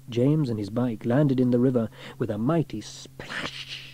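ordinary delivery, nothing marked

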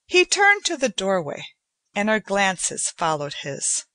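usual speaking voice

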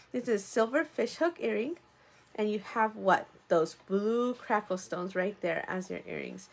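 normal talking voice

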